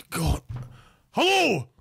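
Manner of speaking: ordinary talk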